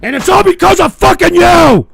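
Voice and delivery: loud yell building to the end; angry blaming shout. Real, not acted. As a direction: explode in accusation. Angry outburst blaming the viewers.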